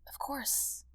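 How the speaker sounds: a soft, gentle whisper; whispering back. Acted. Doctor answers the grateful wife in a whisper. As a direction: barely breathe it back. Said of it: "I whisper back".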